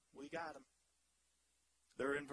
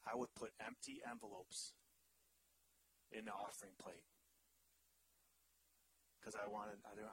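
hushed confession; 10 semitones lower, 10 dB quieter than usual. Real, not acted. Preacher confessing a childhood prank to congregation, hushed. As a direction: drop to a near whisper, confiding.

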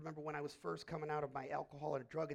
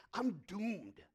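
despairing cry; 6 semitones higher, 8 dB louder than usual. Retold or imitated. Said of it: "fall on their face and say".

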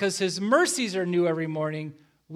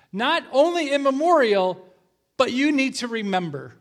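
raised preaching voice; 6 semitones higher, 7 dB louder than usual. Real, not acted. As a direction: push it out louder and higher. Preaching climax.

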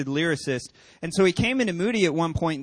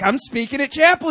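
casual reply; 8 semitones higher, 8 dB louder than usual. Retold or imitated. Pastor answers casually.

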